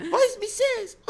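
put-on high squeaky childlike voice, laughing through it; giggly little kid voice; cartoon princess. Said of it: "imagina a criança".

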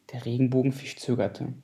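ordinary delivery, nothing marked

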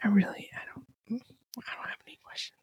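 soft playful whisper. Pretend. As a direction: whisper it teasingly. Whispering as a bit.

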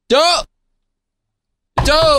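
high-pitched call to rouse a sleeper. Acted. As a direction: holler to wake a friend. Calling out to wake sleeping friend.

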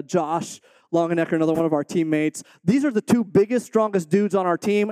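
ordinary delivery

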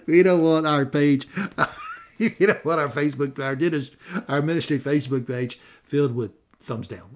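talking loudly through laughter; laughing. Real, not acted. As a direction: laugh your way through the words. Joking about getting thumbs down, laughs.

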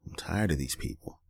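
ordinary delivery

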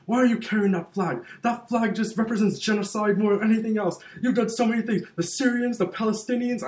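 high-pitched, heated accusation, retold; imitated angry attacker. Imitating a heckler attacking the man with the Israeli flag, indignant. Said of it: "this guy starts attacking the guy".

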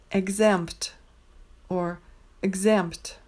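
exempt said with the P, then nearly without; optional P. Shown as a demonstration. Teacher showing the optional P in exempt. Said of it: "Note that the P is optional".

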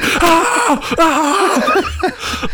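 high, strained cry of disgust; mock anguished wail. Kyle made to eat his banana, high mock wail. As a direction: wail like a kid forced to eat.

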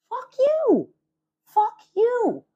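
outraged yell. Real, not acted. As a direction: shout it at the landlords. Outrage at rent prices.

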